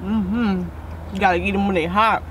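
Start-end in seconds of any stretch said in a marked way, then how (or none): none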